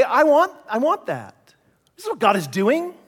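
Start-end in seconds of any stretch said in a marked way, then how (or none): 0.0-1.3 put-on a touch of childlike craving; higher, grabbing at it; eager wanting. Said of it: "they would say".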